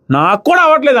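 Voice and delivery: high-pitched, imitated outburst; mock loud retort. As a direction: toss it back like a yelled complaint. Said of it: "అన్నాడు పెద్దగా".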